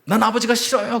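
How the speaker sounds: put-on teenage son screaming at his father; screamed outburst. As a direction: blurt it out like a cry of pain. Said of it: "외마디 비명처럼 소리를 지르더라고요".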